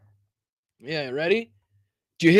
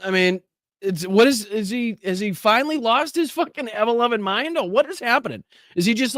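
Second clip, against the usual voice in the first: normal talking voice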